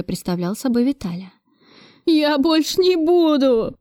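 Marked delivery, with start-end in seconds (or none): 2.1-3.8 put-on high, drawn-out childlike whine; acted sobbing plea. A narrator's reading. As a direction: squeeze out a tearful promise, slowly. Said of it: "судорожно всхлипывая, выдавил из себя Федоркин". (жалобно, будто всхлипывая)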